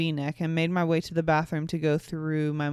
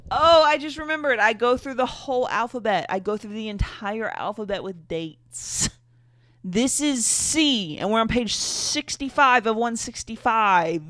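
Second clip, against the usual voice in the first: excited disbelief; 4 semitones higher, 5 dB louder than usual. Real, not acted. Excited exasperation at story length.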